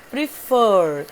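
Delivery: slow, loud "prefer", weight on the drawn-out ending; "prefer" demonstrated with its second syllable stressed; stress on last syllable. Shown as a demonstration. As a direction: stretch and punch the final syllable of "prefer". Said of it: "Fur é a sílaba tônica".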